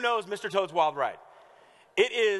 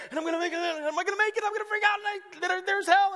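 much higher, fast, rising panic; mock panic; 12 semitones higher, 4 dB louder, much faster than usual. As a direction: spiral into playful alarm at the turns. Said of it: "we're like".

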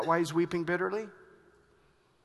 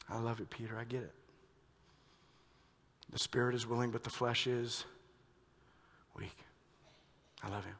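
soft vow; 5 semitones lower, 7 dB quieter, faster than usual. Acted. Peter's quiet vow, intimate.

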